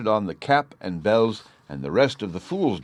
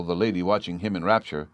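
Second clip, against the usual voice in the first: usual speaking voice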